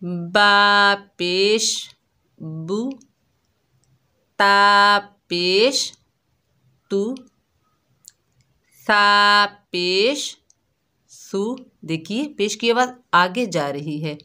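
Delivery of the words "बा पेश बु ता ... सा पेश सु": very slow, syllable by syllable recitation; slow spelling drill. Shown as a demonstration. Teacher leads children through a slow reading drill. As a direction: spell each letter out slowly for the kids to repeat. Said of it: "मैं बहुत आराम आराम से पढ़ूंगी".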